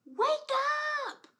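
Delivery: high, loud wake-up call. Shown as a demonstration. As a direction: call out loudly to wake the baby. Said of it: "Let's say, "Wake up!" when I count to three".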